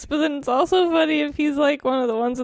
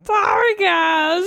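Carl the dinosaur; 8 semitones higher, 4 dB louder, much slower than usual. Pretend. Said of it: "He just, like, accidentally".

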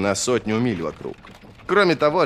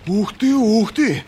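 slow, high, loud cry of wonder; exhaled amazement; 12 semitones higher, 6 dB louder, slower than usual. A narrator's reading. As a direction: marvel aloud, drawing it out high. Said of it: "выдохнул длинноногий". (протяжный восторженный возглас)

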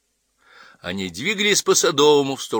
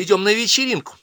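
high, louder flustered excuse; 13 semitones higher, 6 dB louder than usual. Acted. Daughter explains herself to mother, flustered. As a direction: blurt the excuse, thrown off. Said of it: "растерялась Ася".

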